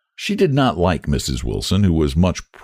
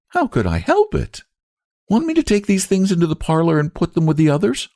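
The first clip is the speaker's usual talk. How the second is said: Lottie voice; 11 semitones higher than usual. A narrator's reading.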